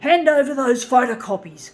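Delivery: loud, stern cop command; policeman order. Retold or imitated. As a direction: bark it like a policeman. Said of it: "Like he was a policeman saying".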